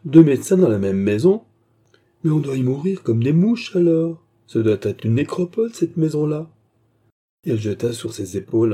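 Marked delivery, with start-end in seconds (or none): 0.0-6.5 exclamation rieuse, plus haute et plus forte; s'exclamer en riant, voix qui monte; s'écrie en riant. A narrator's reading. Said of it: "s'écria Baccarat en riant". (éclat de rire moqueur, plus haut et fort)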